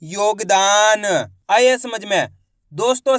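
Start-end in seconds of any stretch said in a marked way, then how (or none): 0.0-1.0 योगदान drawn out slowly, each syllable joined; slow demo blend of योगदान; slow blended reading. Shown as a demonstration. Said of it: "तो मिलके बन जाएगा".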